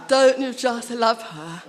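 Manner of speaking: ordinary talk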